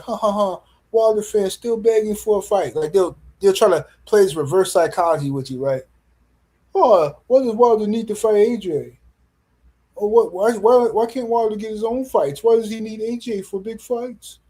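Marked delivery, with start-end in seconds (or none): 0.0-2.7 mocking fans' laugh. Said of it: "put some laughing emojis and be like". 6.7-14.4 high-pitched mock complaint; mocking stupid fans. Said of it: "Stupid stuff like that".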